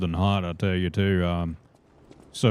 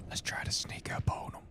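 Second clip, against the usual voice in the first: hushed, unvoiced whisper; sneaky whisper; 12 dB quieter than usual. Real, not acted. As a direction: whisper it so he won't hear. Sneaking up on enemy in game.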